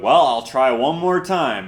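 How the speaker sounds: loud, slow imitation of a huffy commenter; put-upon mock complaint, dragged out; passive-aggressive. Imitating viewers who want an answer, passive-aggressive. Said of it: "it'll get passive aggressive".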